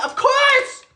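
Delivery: very high, gushing reply; excited exclamation. Real, not acted. Answering the friend he just voiced.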